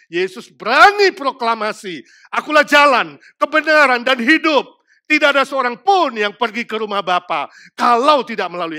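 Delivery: loud, high, driving sermon climax; preaching at full force. Acted. Preacher to congregation, loud driving sermon. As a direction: hammer the gospel home from the pulpit.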